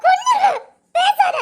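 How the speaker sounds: high, sharp threat in rage; furious cry. Acted. Woman furious after slap. (அடி வாங்கிய மனைவி கோபத்தில் உயர்ந்த குரலில் மிரட்டல்)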